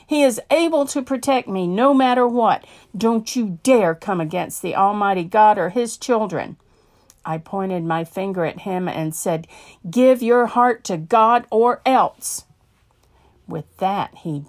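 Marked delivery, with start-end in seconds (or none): 0.1-6.6 preacher proclaiming God's power to a gunman. Retold or imitated. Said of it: "raised one fist and proclaimed". 9.9-12.4 loud, slow ultimatum; preacher's last warning to the gunman; stern warning. Acted. Pointing finger, final warning.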